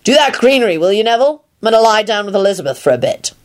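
mild calling-out voice; Lupin, calling downstairs. A narrator's reading. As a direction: shout down the stairs, restrained. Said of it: "shouted down the stairs".

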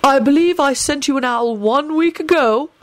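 cool headmaster's rebuke; cold response. A narrator's reading. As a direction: answer with icy formality. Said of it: "Dumbledore responded coldly".

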